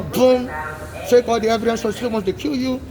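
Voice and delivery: ordinary delivery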